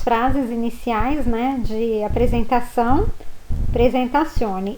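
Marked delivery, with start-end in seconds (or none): none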